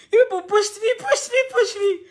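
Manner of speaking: a laughing wail over being pushed; laugh-crying. Real, not acted. Telling followers they pushed her, laughing through a wail.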